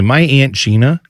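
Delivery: usual speaking voice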